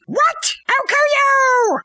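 high shocked squawk into a drawn-out threat; hammed-up vengeful yell; mock fury. Hamlet turns on the king, mock fury.

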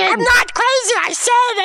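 high-pitched, indignant denial; defensive protest. Acted. Accused storyteller insisting on his sanity, defensive.

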